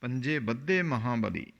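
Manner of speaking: ਬੱਧੇ with a doubled, held dh; doubled consonant in ਬੱਧੇ. Shown as a demonstration. Teacher demonstrating the adhak reading, a doubled dh in ਬੱਧੇ. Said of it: "ਇਹਨੂੰ ਅਦਕ ਲਾ ਕੇ ਬੋਲਣਾ".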